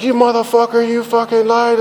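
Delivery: imitating the wife screaming at him for lying; angry wife catching his lie, shouting; furious wife. Wife furious about hidden coke.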